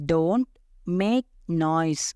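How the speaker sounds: "Don't ... make ... noise", a pause after each word; very slow, separated words; say-along drill. Shown as a demonstration. Teacher leading learners to say it along, word by word. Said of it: "என் கூட சேர்ந்து சொல்லுங்க".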